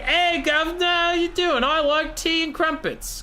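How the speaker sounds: very high, squeaky mock British accent. Other Dark Elves greeting each other, mock British accent. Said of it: "'Cause now they're British".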